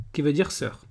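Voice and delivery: normal talking voice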